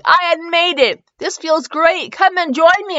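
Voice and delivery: high, excited calling, lightly acted; gleeful. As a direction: invite everyone in with mild glee. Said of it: "I laughed in glee".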